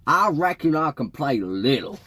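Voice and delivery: a put-on Southern twang, casual; Southern accent, offering to help; fake Southern accent. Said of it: "John said in a fake Southern accent".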